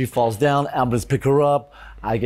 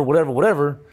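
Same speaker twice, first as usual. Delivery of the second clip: normal talking voice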